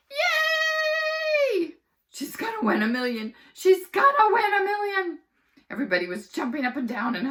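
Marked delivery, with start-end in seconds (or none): 0.1-1.8 very high, loud shouted yay; a crowd of kids cheering. Acted. 2.1-3.3 louder, excited shout; a kid cheering at the TV. Acted. Kids cheering at TV. 3.5-5.2 much higher, much louder screaming cheer; ecstatic shout. Acted. Kids cheering at TV.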